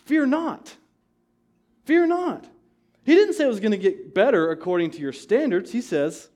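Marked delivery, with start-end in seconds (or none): 0.1-2.5 emphatic cry. Acted. Preacher voicing God.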